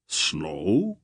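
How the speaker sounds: incredulous. A narrator's reading. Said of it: "Barak asked incredulously".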